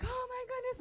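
high-pitched retold panic; shocked alarm. Storyteller finds her room on fire, high alarmed exclamation.

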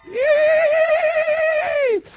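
sharp high party whoop; high hype yell. Real, not acted. Hype yell over music.

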